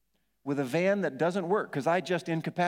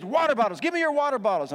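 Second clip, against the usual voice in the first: louder, higher urgent command; wife's urgent call; 8 semitones higher, 6 dB louder than usual. Retold or imitated. Wife demanding the kids' water bottles, loud and urgent. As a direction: bark out the request for bottles. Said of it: "So she says".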